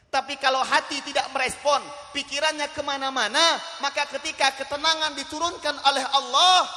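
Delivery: loud, high-pitched preacher's delivery; full-force preaching. Real, not acted. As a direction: drive it out loudly to the whole congregation.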